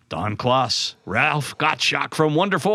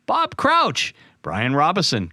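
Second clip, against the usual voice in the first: ordinary delivery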